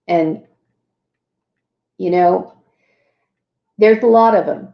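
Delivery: usual speaking voice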